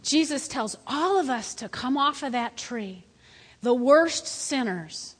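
ordinary talk

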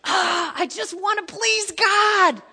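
loud, high, slow plea; yearning cry. Retold or imitated. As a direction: cry out with longing to please God. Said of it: "I go".

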